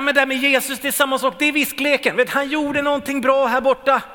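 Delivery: sceptical listener. Retold or imitated. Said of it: "Du kanske tänker så här".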